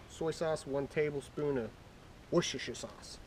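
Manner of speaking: usual speaking voice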